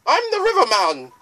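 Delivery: high, loud singsong boatman; lilting ferryman introduction; River Man voice. Acted. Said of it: "Oh, I'm the River Woman".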